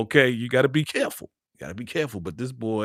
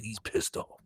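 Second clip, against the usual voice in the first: ordinary delivery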